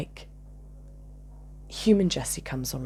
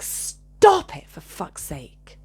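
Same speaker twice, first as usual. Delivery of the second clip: exasperated outburst; much slower than usual. Retold or imitated. Speaker fed up with this behaviour, exasperated outburst. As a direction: burst out, stretching the words.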